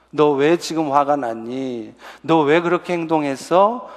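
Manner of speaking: slow, low, gentle parent asking why; soft, unhurried concern; calm questioning. Acted. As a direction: ask an upset child calmly, taking your time. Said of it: "하고 차분하게".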